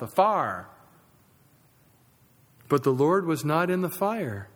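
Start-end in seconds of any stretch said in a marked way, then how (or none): 0.0-0.7 Southern US accent. Shown as a demonstration. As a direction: fire in a Southern drawl, as one syllable "far". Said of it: "a single syllable word in the South".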